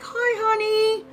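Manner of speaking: high, slow cooing at the hemorrhoid; mock-sweet high coo; sweet baby talk. Talking to her hemorrhoid.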